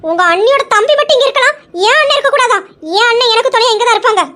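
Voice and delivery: high, loud indignant pleading; angry protest. Acted. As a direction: fight back for your brother. Wife protests husband sending brother away. (கோபமான ஆதங்கக் குரல்)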